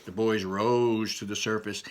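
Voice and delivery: ordinary delivery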